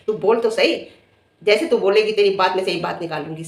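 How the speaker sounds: usual speaking voice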